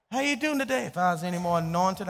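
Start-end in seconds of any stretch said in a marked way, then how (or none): 0.1-0.9 mock cheery greeting. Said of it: "great church answers".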